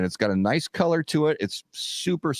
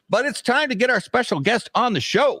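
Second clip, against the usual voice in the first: announcer voice. Acted. Host intro announcer voice.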